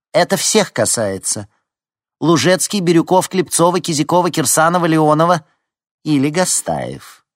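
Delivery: loud, high, emphatic list of names; stern roll call. A narrator's reading. Head teacher naming those who must compete, sternly. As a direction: call out each name forcefully, drawing out the last.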